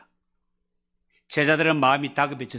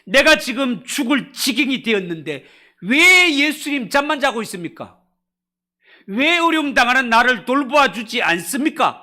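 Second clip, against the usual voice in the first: loud, high, panicked pleading; frightened complaint; 7 semitones higher, 6 dB louder than usual. Retold or imitated. Disciples in the storm accusing Jesus, loud panicked plea. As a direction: cry out at a sleeping master in fear. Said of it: "지금 두려움에 사로잡혀서".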